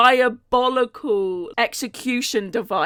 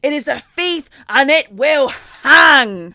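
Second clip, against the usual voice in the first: slow declamation building to a loud roar; hammed-up, drawn-out outrage; irate; 4 semitones higher, 6 dB louder, slower than usual. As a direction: thunder it word by word like a vow. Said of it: "quite irate by this".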